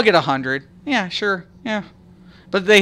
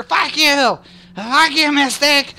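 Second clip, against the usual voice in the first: imitating trolls; 9 semitones higher, slower than usual. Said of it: "They're like".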